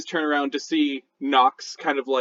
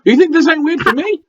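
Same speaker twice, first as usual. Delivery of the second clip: high, loud critter protest; talking raccoon, indignant; 12 semitones higher, 10 dB louder, faster than usual. Acted. Raccoon answering a friend who finds this weird, high and indignant. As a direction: throw it back in a raised raccoon voice.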